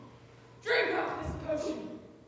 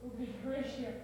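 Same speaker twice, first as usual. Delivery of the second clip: ordinary talk, nothing marked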